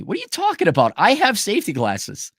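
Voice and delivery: high-pitched mock outrage; indignant protest. Host answering a viewer's tale about safety glasses, indignant protest. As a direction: protest like you've been falsely accused.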